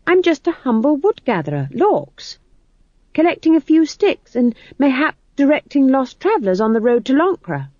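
high, emphatic put-on rustic act; McGrath playing humble peasant. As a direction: recite the humble wood-gatherer act. McGrath plays wood gatherer.

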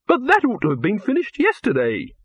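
high-pitched wail of dismay. A narrator's reading. Director learns the actress is still filming elsewhere, wailing. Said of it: "wailed Silverfish".

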